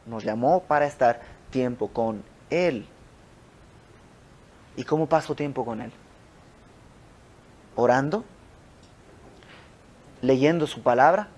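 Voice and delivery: ordinary talk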